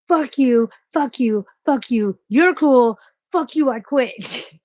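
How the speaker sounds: loud, high mock shouting of fuck you; imitating a movie rant, loud and high, slowing at I quit; movie quote yell. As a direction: ham it up like a fed-up fast food kid. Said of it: "And the kid's like".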